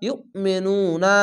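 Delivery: usual speaking voice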